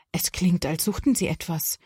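plain reading voice

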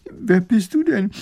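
high, loud tearful question; sobbing. A narrator's reading. Weeping widow asks a young lady who she is, sobbing. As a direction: ask it through sobs, pitched high. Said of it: "schluchzt die Fischerwitwe".

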